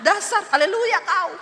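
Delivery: loud, mock-angry jab; playful yelled insult; mock quarrel shout. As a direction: snap at the spouse in jest. Imagined marital quarrel.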